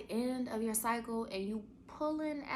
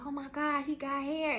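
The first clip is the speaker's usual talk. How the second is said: excited husband; 7 semitones higher than usual. Retold or imitated. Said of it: "he was like".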